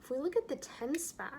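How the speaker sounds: ordinary talk, nothing marked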